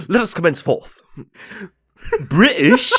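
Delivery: high, loud mock-British voice; British meme. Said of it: "that fucking meme".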